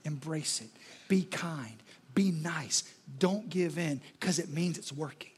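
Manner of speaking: soft, low reassuring voice; calm them like a kind teacher; gentle Jesus. Retold or imitated. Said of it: "Which is why Jesus says".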